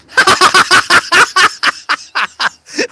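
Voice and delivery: loud high cackle at own joke; bursting out laughing, high and loud; high burst of laughter. Real, not acted. Comedian cracking up at his own joke, loud high laugh.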